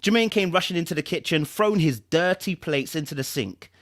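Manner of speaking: measured storytelling voice, lightly dramatic; dramatic story narration. Acted. Reading a novel's prologue to viewers, dramatic narration. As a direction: draw the listener into a dark story.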